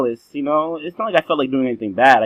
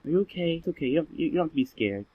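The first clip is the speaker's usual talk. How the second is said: gentle, much softer reassurance; soft comforting teacher; 3 semitones higher, 8 dB quieter, faster than usual. Retold or imitated. Teacher softly comforting a crying boy, hushed and gentle. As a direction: soothe a scared little kid softly. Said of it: "and she said".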